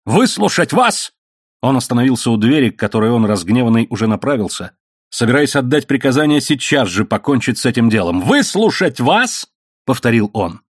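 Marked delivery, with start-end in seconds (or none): 0.0-1.1 slow, high, incredulous question; anger and irritation. A narrator's reading. Man refuses to hear her plea, irritated. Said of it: "в его голосе был гнев и раздражение". 8.2-9.5 drawn-out, high-pitched indignant repeat; anger and irritation. A narrator's reading. Angry man at the door repeats her request, exasperated. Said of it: "в его голосе был гнев и раздражение".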